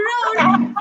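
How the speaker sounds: high, loud mock lion roar; teacher showing the kids a lion roar. Shown as a demonstration. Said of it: "Would you get scared like this?".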